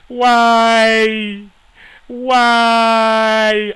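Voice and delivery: high, loud, drawn-out why; anguished shout. Real, not acted. Gamer howling at the referee over the cancelled goal, loud.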